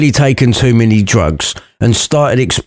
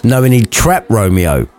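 ordinary talk

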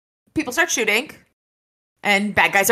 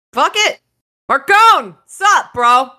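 higher and louder, a jokey shout up to a friend; mock exclamation; 9 semitones higher, 9 dB louder, slower than usual. Harry calling up to Marcone, a hammed-up shout. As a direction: bark it out like you're calling across a pit.

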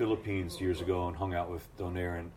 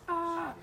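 imitated crowd roar. Crowd roaring at the name call, mock cheer. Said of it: "and the crowd's".

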